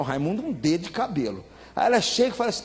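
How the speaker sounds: ordinary talk, nothing marked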